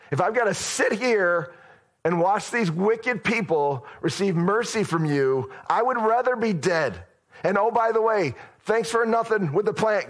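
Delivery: petulant prophet building from high complaint to a shout; Jonah's furious rant. Retold or imitated. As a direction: whine at God, then explode. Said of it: "That's literally what he said".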